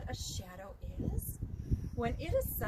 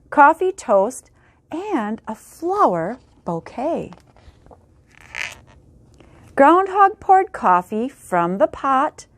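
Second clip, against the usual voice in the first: put-on lively, louder storybook reading for kids; reading to children; 22 dB louder than usual. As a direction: read it out big for a class of little ones. Reads a picture book aloud.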